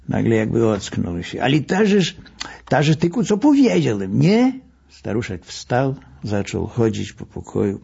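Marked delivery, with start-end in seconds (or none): 1.4-4.5 doctor snapping out of it, pitched up; old doctor startled by his own words; sudden realisation. A narrator's reading. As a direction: catch yourself mid-thought.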